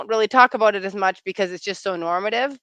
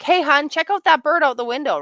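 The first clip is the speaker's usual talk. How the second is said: higher, louder, eager call to partner; wife calling partner over to see a bird, eager; chirpy bid for attention; 7 semitones higher, 5 dB louder than usual. Retold or imitated. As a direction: point out the bird with bright eagerness. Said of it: "very small things like".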